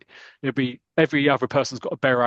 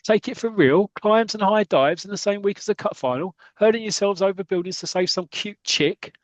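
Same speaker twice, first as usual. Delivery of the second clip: high-pitched incredulous telling-off; mock furious manager; 7 semitones higher than usual. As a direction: act out the manager blowing his stack, voice raised high. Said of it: "He'll blow his stack".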